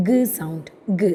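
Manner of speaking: hard short "guh" for G; G as hard sound. Shown as a demonstration. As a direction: say G as "guh", not "jee". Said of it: "written to pronounce G sound". (G എന്നത് "ഗ്" ശബ്ദമായി)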